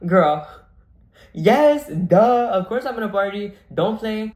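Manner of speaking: loud, high playful insistence; emphatic duh. Retold or imitated. Daughter insisting to mom she will party, emphatic duh.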